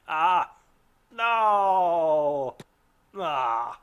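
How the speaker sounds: loud, high despairing cry; real anguish, wailing. Real, not acted. Gamer watching his fighters fall, anguished crying out. Party overrun by spiders.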